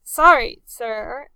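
loud, flustered apology; stammered. A narrator's reading. Nervous midshipman stammering an apology to the captain. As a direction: blurt it out, caught off guard. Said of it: "Talbot stammered".

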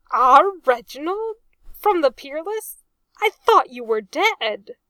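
high, loud disbelieving question; astonished recognition. A narrator's reading. Arthur recognising his friend believed dead, loud amazement.

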